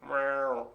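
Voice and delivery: imitated cat's meow; one drawn-out meow. Host punning on the Egyptian Mao, meowing like a cat.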